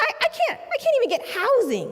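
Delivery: high, strained despair; a man at the end of his rope; desperate client. Retold or imitated. Client pleading to lawyer, high and desperate.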